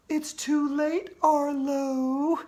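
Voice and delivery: high, slow ghostly taunt; eerie ghost girl gloating. Acted. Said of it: "the ghost of Andrea told me".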